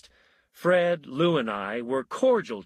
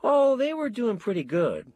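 put-on young boy's voice; 10 semitones higher than usual. A narrator's reading. Son answers as if dreaming.

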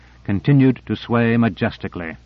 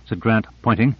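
plain reading voice

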